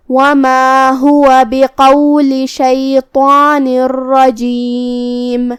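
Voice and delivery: drawn-out melodic tajweed chant; slow Quran recitation. Shown as a demonstration. A teacher reciting the verse for students, slow melodic chant. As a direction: recite the verse slowly with full elongations.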